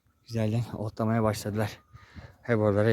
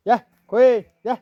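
high, louder herding call; 10 semitones higher, 9 dB louder, slower than usual. Real, not acted. Vlogger calling the sheep toward the barn, high loud call.

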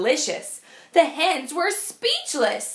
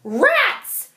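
squawk; 9 semitones higher, 4 dB louder than usual. Acted. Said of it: "squawked a hen".

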